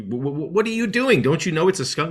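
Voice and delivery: high-pitched shocked owner; shocked scolding. Retold or imitated. Owner scolding the puppy after the skunk, in high shocked disbelief. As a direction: squeak out the disbelief at the dog.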